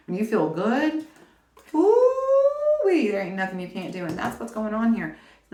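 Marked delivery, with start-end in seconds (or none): 1.7-3.1 high, loud drawn-out "woo-wee"; excited whoop. Real, not acted. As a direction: let out a long, high, delighted whoop. Excited cheer about feeling good.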